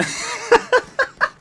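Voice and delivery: squeaky high-pitched laugh. Real, not acted. Laughing at the story.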